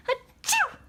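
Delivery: high playful pretend sneeze; a big pretend achoo for the baby; fake sneeze. Dad fakes a sneeze for baby, high. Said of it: "he didn't do it".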